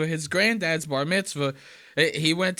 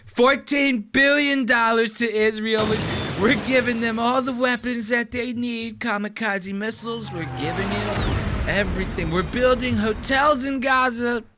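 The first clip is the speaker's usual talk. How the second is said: Donald Trump impression; 7 semitones higher than usual. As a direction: bellow like a bragging president. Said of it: "he goes".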